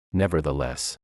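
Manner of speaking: usual speaking voice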